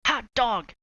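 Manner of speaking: put-on high, loud cheer of kids; excited cry. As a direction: whoop with joy. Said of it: "cried the class".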